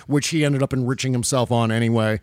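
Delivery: normal talking voice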